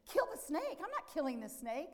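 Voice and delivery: high, loud disbelieving echo; incredulous refusal. Retold or imitated. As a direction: mimic the neighbour balking at the order. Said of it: "He goes".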